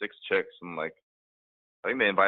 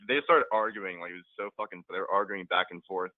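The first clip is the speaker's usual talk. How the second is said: normal talking voice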